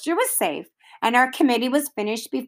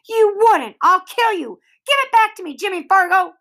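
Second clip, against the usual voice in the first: put-on high, loud panicked girl's scream; Sheila screaming; 8 semitones higher, 5 dB louder than usual. A narrator's reading. Sheila screams at Jimmy to give the booklet back, high and loud. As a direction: shriek it, about to cry. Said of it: "Sheila screamed".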